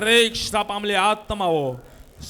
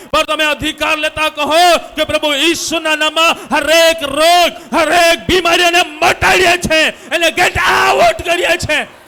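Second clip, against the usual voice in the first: impassioned preacher building to a shout; rousing healing declaration, building in force; fervent preaching; 5 semitones higher, 8 dB louder than usual. Real, not acted. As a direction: urge the crowd to cry out. Urges congregation to cry out.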